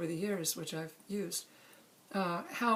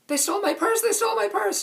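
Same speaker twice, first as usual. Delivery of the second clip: frantic high screamed cry, much louder; screaming; 13 semitones higher, 11 dB louder than usual. Retold or imitated. Woman robbed in the street, screaming high and loud. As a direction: scream it over and over, panicked.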